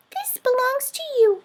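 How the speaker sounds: put-on tiny mouse giving back the baby; squeaky mouse. A narrator's reading. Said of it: "he said".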